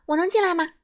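can't wait to get inside; pushy cheerful caller; brisk eager voice. Acted. Mr. Busy at the door.

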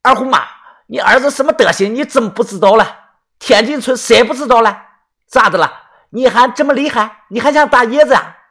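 indignant scolding. Acted. Villager confronts Erhongzi's mother, high indignant scolding. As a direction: take someone to task in public.

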